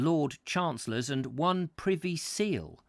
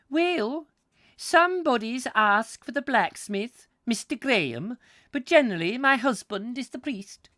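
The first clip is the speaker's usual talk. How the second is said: high-pitched, loud Scotswoman; hammed-up Scottish wife, pitched way up; broad Scottish accent; 11 semitones higher, 6 dB louder than usual. A narrator's reading. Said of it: "in a very broad accent".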